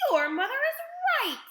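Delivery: very high, loud shout; alarmed father shouting. A narrator's reading. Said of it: "shouted Mister Beauregard".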